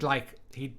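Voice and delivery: ordinary talk, nothing marked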